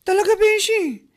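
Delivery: high incredulous gasp of a question; mock surprise. Coworker gasps at the news of debt, mock surprise. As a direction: act shocked at the gossip.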